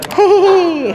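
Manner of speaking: shrill-free high little laugh, loud; high squealing giggle. Real, not acted. Patient pressed on sore spot, high loud giggle.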